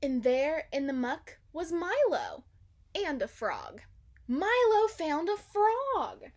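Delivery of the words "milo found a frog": high, loud, slow joyful cry; excited exclaim. A narrator's reading. Narrator crying out happily that the dog found a frog. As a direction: burst out with delight.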